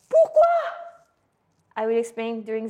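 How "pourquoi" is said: high, loud outburst of "why?"; mock bafflement. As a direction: squeal it in playful disbelief. Voices a baffled student's question. (stupéfaction feinte)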